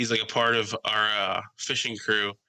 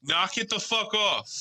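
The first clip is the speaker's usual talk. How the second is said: slow, high imitation of a dog owner; mock scolding; 10 semitones higher, slower than usual. Girl at the bar to the dog, mock scolding. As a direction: drag out the telling-off, pitched up.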